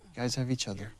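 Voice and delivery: whispered aside to the actors; playful whisper. Real, not acted. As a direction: breathe it as a teasing secret. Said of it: "joking whisper to actors".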